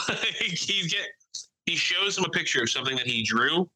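ordinary talk, nothing marked

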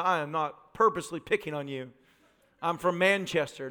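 ordinary talk

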